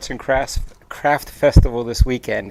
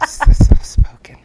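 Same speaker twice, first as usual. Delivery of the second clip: exaggerated breathy whisper of a shy woman; fake soft whisper; 10 dB louder than usual. Husband teasing his wife with a fake soft-spoken whisper. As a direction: whisper it like a mock shy girl. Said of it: "that's a fake soft voice".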